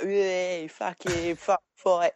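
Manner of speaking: high, hammed-up squaddy voice; squaddy impression. Imitating a squaddy spoiling for a fight, high mock yell. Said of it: "he's like a squaddy".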